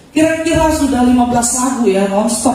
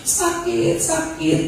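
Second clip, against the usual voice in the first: soft, high, whiny little voice; quiet child's whimper; 7 semitones higher, 6 dB quieter, much slower than usual. Retold or imitated. Mother imitates her crying son complaining of pain, soft and high. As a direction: whimper it like a sick little boy. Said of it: "anak saya nangis lagi".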